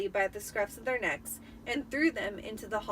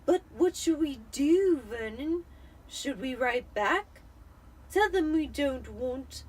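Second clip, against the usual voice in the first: a touch higher, worried wife; anxious Aunt Petunia; 4 semitones higher, 5 dB louder, slower than usual. Acted. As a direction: plead with her husband, nervous.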